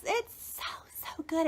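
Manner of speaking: soft, hushed rave; awed whisper. Real, not acted. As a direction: whisper it as if overcome. Awed praise of the CD.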